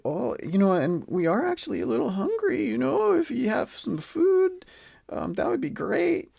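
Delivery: high, tentative acted request; hint politely, pitch climbing as you go; polite hungry guest. Adventurers politely ask orcs for food.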